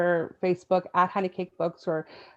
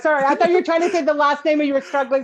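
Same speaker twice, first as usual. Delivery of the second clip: higher and louder, quick embarrassed apology; flustered apology; 8 semitones higher, 10 dB louder, faster than usual. Real, not acted. Guest apologizing to host for the name mix-up, flustered and fast. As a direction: rush out a laughing apology.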